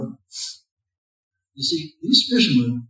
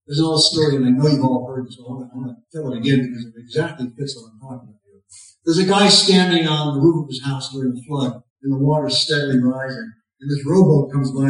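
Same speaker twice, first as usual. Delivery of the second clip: ordinary talk, nothing marked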